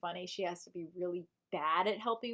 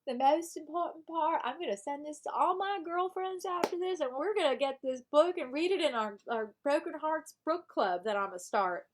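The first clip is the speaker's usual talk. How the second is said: hammed-up Southern book club leader; improvised Southern character; 3 semitones higher than usual. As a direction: play a chatty Southern breakup-advice character. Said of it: "I'm just improving now for this character I created".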